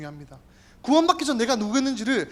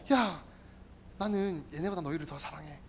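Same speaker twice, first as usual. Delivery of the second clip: much softer and lower, intimate; tender God; 7 semitones lower, 14 dB quieter than usual. Acted. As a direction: whisper God's love close to the ear. God's tender aside. (낮고 부드럽게 속삭이듯)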